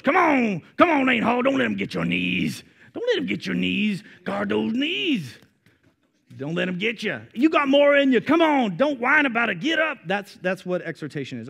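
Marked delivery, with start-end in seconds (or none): 0.0-10.0 louder and higher, pushing a player to get up; football coach. Retold or imitated. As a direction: bark it like a coach on the sideline. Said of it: "like a football coach".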